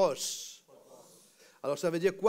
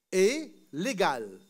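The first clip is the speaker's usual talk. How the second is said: usual speaking voice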